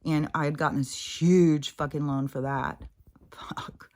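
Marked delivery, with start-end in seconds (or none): 3.3-3.9 breathed, almost silent swear; whispered curse. Real, not acted. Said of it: "whispered curse".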